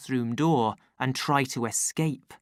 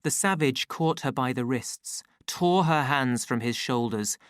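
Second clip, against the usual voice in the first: usual speaking voice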